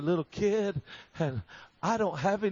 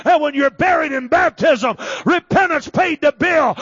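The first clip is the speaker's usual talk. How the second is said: loud, driving preacher's delivery; full-force preaching; 5 semitones higher, 10 dB louder than usual. Real, not acted. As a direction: build higher and louder with each phrase.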